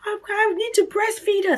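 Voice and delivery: high-pitched, re-enacted wail; mock-crying, fretting in a very high voice; imitated tearful panic. Mother fretting over feeding her newborn, high imitated wail. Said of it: "I just start crying".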